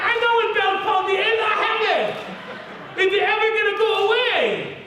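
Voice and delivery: very high-pitched mock panic; frantic outburst. Patient cutting off the doctor, hammed-up high panic.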